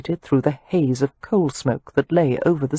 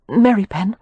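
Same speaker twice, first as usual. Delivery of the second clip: strained voice; 4 semitones higher than usual. Acted. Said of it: "Her voice was strained".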